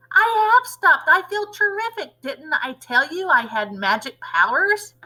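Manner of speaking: high crone voice, gloating; boastful grandma. Acted. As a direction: lord it over the boy like a witch.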